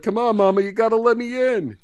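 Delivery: hungry man begging at the door; pleading at the door. Acted. Eddie pleading at restaurant door.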